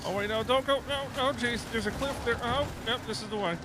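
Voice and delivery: high, loud excited calls; urgent steering through danger; excited shouting. Real, not acted. Guiding through danger in game.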